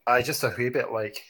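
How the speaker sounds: put-on light Scottish accent on "aye"; a hint of a Scottish local. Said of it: "some Scottish accent".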